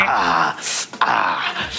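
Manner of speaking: low imitated duck noise; duck sound. Shown as a demonstration. Co-host showing off his duck sound, imitated quack. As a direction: do a low duck call, twice. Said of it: "That's my duck sound".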